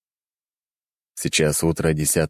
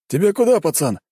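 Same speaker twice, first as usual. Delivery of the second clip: gruff driver; 11 semitones higher, 3 dB louder than usual. Acted. Driver voiced by narrator.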